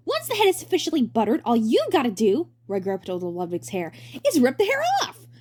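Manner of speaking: hammy stage actor. Acted. Roy presenting.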